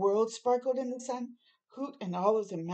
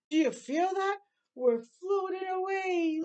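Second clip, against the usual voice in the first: alarmed; 10 semitones higher than usual. Acted. House floating, child alarmed.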